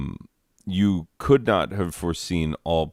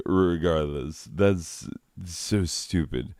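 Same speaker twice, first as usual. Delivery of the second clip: normal talking voice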